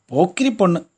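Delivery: loud, high, through gritted teeth; mother pinching her daughter's cheek in delight; fond scolding. A narrator's reading. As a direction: affectionately scold the cheeky girl. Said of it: "பல்லை இறுக கட்டிக்கொண்டு".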